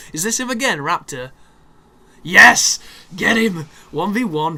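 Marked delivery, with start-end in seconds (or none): 2.2-4.6 loud, high cheering; excited cheer. Real, not acted. Gamer loudly cheering as the enemies fight.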